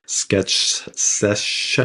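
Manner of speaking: SKETCH and SESH said as two separate, careful words; careful tongue twister. Shown as a demonstration. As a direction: pick through "Sketch sesh" word by word, drawing out the hushing end of "sesh". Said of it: "trying to say it is a little odd".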